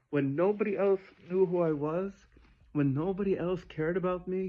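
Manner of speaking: impassioned. Acted. King telling his only true friend, impassioned.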